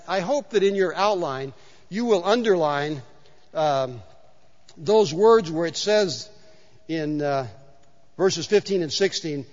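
normal talking voice

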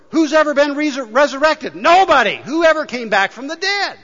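loud, high-pitched preaching; emphatic rhetorical challenge. Real, not acted. Preacher challenging congregation on resurrection, loud and emphatic. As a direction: fire the questions out loud and high.

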